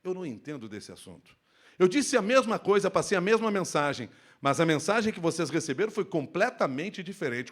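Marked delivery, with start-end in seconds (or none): 0.0-1.3 lower, softer, gentle; subdued low voice. Shown as a demonstration. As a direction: say it quietly and calmly. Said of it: "E eu posso dizer".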